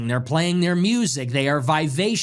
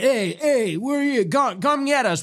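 mock taunt; 8 semitones higher than usual. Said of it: "they're saying".

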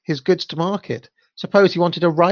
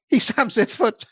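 high teasing giggly voice; playful mocking children; children laughing; 7 semitones higher than usual. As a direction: laugh through it like kids. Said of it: "they cried".

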